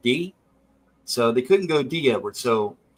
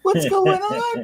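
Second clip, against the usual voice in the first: very high, slow, hammed-up sleazy croon; nerdy pimp voice; 4 dB louder, much slower than usual. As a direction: play the creepy nerd cooing at a stripper, way up high.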